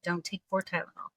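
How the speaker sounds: ordinary talk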